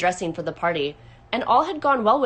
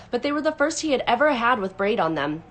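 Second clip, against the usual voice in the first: usual speaking voice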